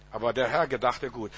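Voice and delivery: low, soft reassurance; hushed counterpoint. Real, not acted. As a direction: drop right down for the answer. Quiet answer to the loud line.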